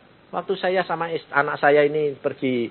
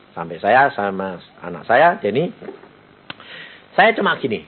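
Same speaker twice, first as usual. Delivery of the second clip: ordinary talk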